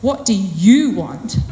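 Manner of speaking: slow question with a big swell on "you"; stress on you. Shown as a demonstration. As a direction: punch the word "you", slowly. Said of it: "put stress or emphasis on a different word".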